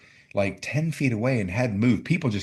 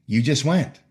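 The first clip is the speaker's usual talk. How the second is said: fired up; 6 semitones higher, 6 dB louder than usual. Retold or imitated. Fired up praising her.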